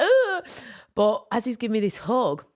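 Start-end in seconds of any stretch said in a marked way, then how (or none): 0.0-0.4 high, loud irritated ugh; annoyed groan. Retold or imitated. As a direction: push the hug away. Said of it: "usually I'd push him away".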